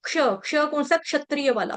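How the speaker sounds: ordinary delivery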